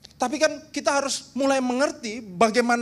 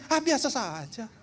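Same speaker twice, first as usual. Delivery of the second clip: indifferent heart. Retold or imitated.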